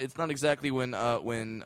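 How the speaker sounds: ordinary delivery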